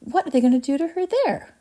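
high-pitched anxious question; alarmed cry. A narrator's reading. Mother fears the juicing room, alarmed cry. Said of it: "cried Missus Beauregard".

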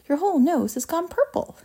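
high-pitched, mildly shouted alarm. A narrator's reading. Father sees Violet's purple nose, mild alarmed shout. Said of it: "shouted Mister Beauregard".

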